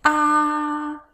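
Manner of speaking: "Ah" held long, high and level; first tone. Shown as a demonstration. Teacher reviews the tones, high level "ah". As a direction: keep "ah" steady on a high pitch. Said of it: "Let's review the tones".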